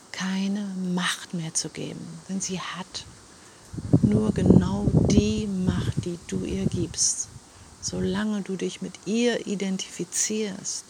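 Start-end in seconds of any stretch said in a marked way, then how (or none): 4.0-6.0 slow, loud, each word weighed; slow emphasis. Real, not acted. Coach telling viewers their power, slow stressed words. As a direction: lean hard on nur genau die Macht. Key point of her message.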